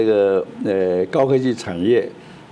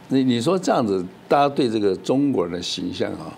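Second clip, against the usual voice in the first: ordinary talk, nothing marked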